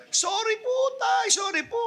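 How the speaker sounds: imitating a high, crying shout; mock wailing apology. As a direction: wail it like a mourner at a funeral. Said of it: "sisigaw pa na".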